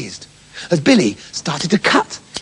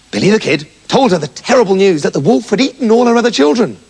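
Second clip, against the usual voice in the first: ordinary delivery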